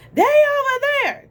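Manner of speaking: high, loud yell across the street; yelling neighbor. Retold or imitated. Nosy neighbor on her porch yelling to the driver, loud and high. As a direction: call it out like a nosy old lady. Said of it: "she yells out".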